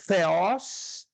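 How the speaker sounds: "theos, theou, theon" drawn out slowly; listing declensions for students; slow dictation. Shown as a demonstration. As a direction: spell out each form slowly. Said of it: "três declinações pra Deus".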